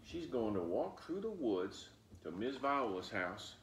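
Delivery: ordinary delivery, nothing marked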